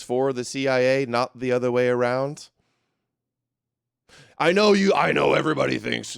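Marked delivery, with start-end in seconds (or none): 4.4-6.2 lightly done Jones impression, high and loud at the start; a hint of Jones ranting to his audience. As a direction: slip into a hammed-up Jones rant voice. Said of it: "I don't know why I'm doing the Jones voice".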